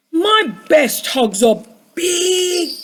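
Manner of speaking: slow, drawn-out storybook voice; Douglas. A narrator's reading. Storyteller voices Douglas for listening children, slow and drawn out. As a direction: stretch BIG right out. Voicing Douglas, stretching out big.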